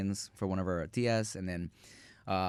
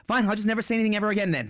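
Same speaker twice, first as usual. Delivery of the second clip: higher, louder, fast mock sulk; imitating mom; 9 semitones higher, 4 dB louder, much faster than usual. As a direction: toss it off like a martyred mother. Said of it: "my mom is, like".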